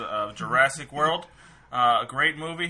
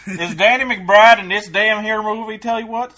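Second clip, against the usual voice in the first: put-on Southern accent; 7 semitones higher, 10 dB louder than usual. Mock redneck drawl.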